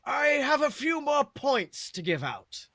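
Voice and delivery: slow, high shout across a great hall; a headmaster's slow, high, loud pronouncement; booming Dumbledore. A narrator's reading. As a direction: boom it out like the headmaster making an announcement. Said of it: "Dumbledore's voice booms out".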